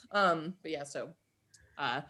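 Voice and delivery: usual speaking voice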